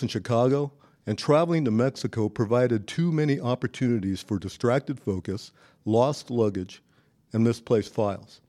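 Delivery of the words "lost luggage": usual speaking voice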